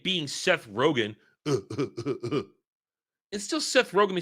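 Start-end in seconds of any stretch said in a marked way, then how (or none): 1.4-2.4 low, pulsing mock laugh; four short put-on chuckles in an even row; imitated chuckle. Mimicking Seth Rogen's laugh.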